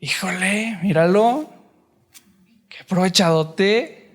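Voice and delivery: mock-disgusted tut at a freeloader; scornful disapproval. Imagined God sneering at someone who asks too much, scornfully. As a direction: sneer it like a put-off judge.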